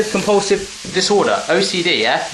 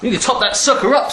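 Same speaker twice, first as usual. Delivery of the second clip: higher, playful hyped-up delivery; pitched-up, jokey enthusiasm; hammed-up excitement; 7 semitones higher than usual. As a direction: punch it up with mock gusto. Hammed-up repeat of the previous line.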